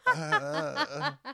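high laughing mock reply; imitating a South African, laughing. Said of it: "Every South African's like".

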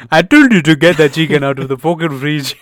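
loud angry mum in a put-on African accent; mock African accent. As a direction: scold hard in a hammed-up African accent. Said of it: "She had an accent".

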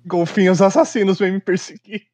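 high slow hammed-up fear; mock dread. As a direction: ham up the terror.

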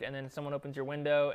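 normal talking voice

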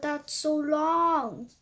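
the dog groaning at Cupcake's request; whiny groan. Acted. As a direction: stretch it out in dismay. Dog groans at long wait.

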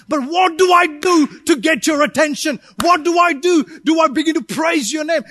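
loud, rising shouted preaching; fiery preaching. Acted. Preacher voicing the woman's cry to God, shouting. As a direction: build to an urgent cry.

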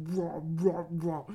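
loud growly chomping noises; hammed-up chomp sounds; mock chomping. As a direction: gnaw at it loud, like a beast. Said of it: "eating it like that".

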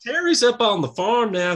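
high, loud mock wife; imitating the wife. Wife telling caller where husband is, high-pitched mimicry. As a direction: chirp it in a put-on wife voice. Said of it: "she was like".

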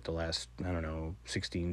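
ordinary delivery

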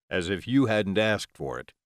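ordinary delivery, nothing marked

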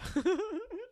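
very high giggle; laughing. Real, not acted. Host giggles after quoting CGI note, laughing.

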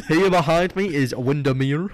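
loud, slow, hammed-up tour guide; Lake Man presenting. Mock superhero showing kids a lake, loud presenter voice.

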